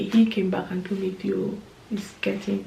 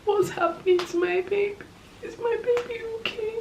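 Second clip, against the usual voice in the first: panicked mother's plea; 11 semitones higher than usual. Retold or imitated. Mother reliving asking staff about her baby, panicked and pleading. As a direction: beg for news of the baby in rising panic. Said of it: "I was just like".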